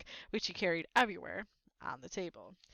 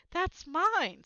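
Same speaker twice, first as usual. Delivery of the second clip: loud, higher protest; indignant claim; 9 semitones higher, 7 dB louder than usual. A narrator's reading. Harry protesting to his uncle over the letter, loud and indignant. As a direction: grab it back as you say it. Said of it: "said Harry, trying to snatch it back".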